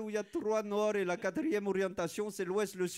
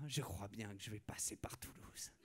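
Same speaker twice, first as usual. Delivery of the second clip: whispered scheming aside; 9 semitones lower, 14 dB quieter than usual. Acted. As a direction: breathe it like a secret plot. Sly plan aside.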